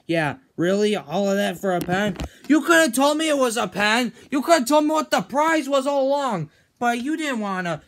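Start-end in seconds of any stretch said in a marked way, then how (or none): 2.5-7.8 higher and louder indignant yelling; furious over a pen; angry complaint. Acted. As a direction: scold him for hiding it. Arguing over the prize.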